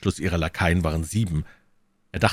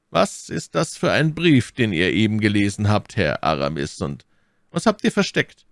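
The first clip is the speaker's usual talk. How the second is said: higher, sharp demanding Cardinal voice; 3 semitones higher, 3 dB louder than usual. Acted. Cardinal demands to see the hidden letter, sharply. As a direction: press them like a suspicious prelate.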